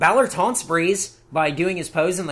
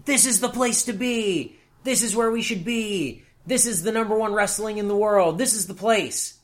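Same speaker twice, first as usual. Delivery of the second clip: mock promo voice; 4 semitones higher than usual. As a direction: parody wrestlers hyping the crowd, stretching each line. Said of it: "everyone coming out and cutting promos and going".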